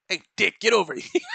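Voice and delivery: mocking a name by shouting it across a room; mock call. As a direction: holler at him for a laugh. Mock calling someone named Dick.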